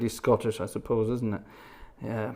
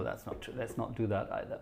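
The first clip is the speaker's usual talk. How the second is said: a quick unvoiced aside, much softer; whispered aside; 2 semitones lower, 12 dB quieter than usual. Real, not acted. Correcting himself about admiring organists, in a whispered aside. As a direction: whisper it to yourself, half joking.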